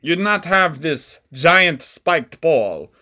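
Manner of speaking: put-on loud, rough-and-ready Romanian accent; attempted Romanian accent. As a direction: taunt the victim in a hammy Romanian accent. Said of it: "I don't know if that's how Romanians talk".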